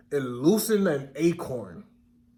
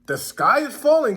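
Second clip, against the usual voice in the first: higher, louder alarmed cry; 5 semitones higher, 5 dB louder than usual. A narrator's reading. Hen and duck warn the turkey with an alarmed cry. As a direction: call the warning out. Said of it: "cried Henny Penny and Ducky Lucky".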